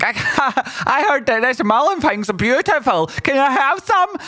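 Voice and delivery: high, breathless mock eager buyer; hammed-up eager newbie; naive buyer voice. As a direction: squeak it out like a gullible newbie. Imitating naive buyers wanting in.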